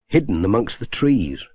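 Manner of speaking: usual speaking voice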